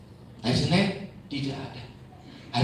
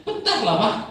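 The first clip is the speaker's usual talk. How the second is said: daughter brushing off; 10 semitones higher, 6 dB louder than usual. Retold or imitated.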